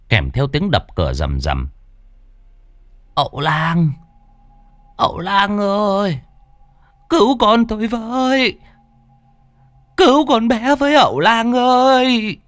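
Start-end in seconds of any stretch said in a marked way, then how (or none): 3.1-12.4 a frantic father calling for help at night; desperate calling. Acted. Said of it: "nghe tiếng gọi".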